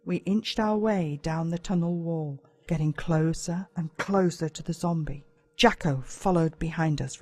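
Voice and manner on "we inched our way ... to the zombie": ordinary delivery, nothing marked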